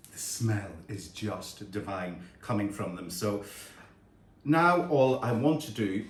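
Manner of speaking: ordinary talk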